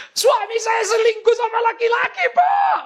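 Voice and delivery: piercingly high, crying shriek; mock hysterical wife. Wife reveals to pastor her husband's male lover, mock hysterical cry. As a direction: shriek and sob it, imitating the wife. Acts out wife's shrieked revelation.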